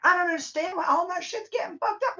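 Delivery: high, whining mock voice of a self-pitying person; whiny mock complainer. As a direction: play the clueless victim, whine it way up high. Mocking a clueless complainer.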